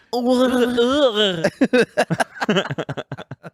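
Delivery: long high gurgling groan; strained high fish noise, trailing off; blowfish impression. Shown as a demonstration. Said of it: "Language influence blowfish".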